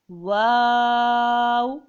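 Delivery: واو held very long, loud and steady; five-alif stretch. Shown as a demonstration. Teacher demonstrating the length, long held واو. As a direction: draw out واو on one note. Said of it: "پانچ الف کی مقدار کھینچ کے".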